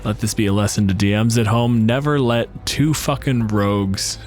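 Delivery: ordinary talk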